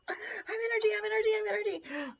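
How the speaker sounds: high, loud, squealed burst of hyper energy; manic, high-pitched mock buzz; frantic energy burst. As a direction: squeal it like a sudden caffeine rush. Acts out caffeine's burst of energy.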